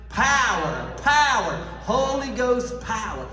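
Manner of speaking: loud, high shouted call of power. Real, not acted. Preacher leading the church in a loud shout of power. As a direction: shout it out with the congregation. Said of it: "Can we shout it?".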